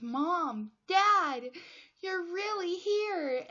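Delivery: rising high and loud, slowing on "you're really here"; overwhelmed cry. Retold or imitated. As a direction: call out as if you can't believe they're real. Arnold seeing his parents again.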